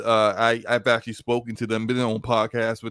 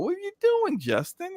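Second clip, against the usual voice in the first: much higher scolding question; mock readers' voice. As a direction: scold Justin in a squeaky voice. Readers chiding Justin.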